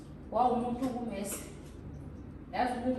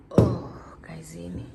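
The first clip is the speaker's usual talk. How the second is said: whispering; 5 semitones lower, 3 dB louder than usual. Real, not acted. Whispers to viewers.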